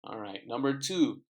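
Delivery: ordinary delivery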